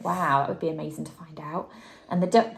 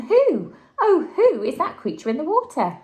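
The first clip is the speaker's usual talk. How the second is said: high, loud, startled with a laugh; surprised; 9 semitones higher, 6 dB louder than usual. Acted. Drip startled by his own reflection, high and laughing. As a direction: gasp at the strange creature in the water.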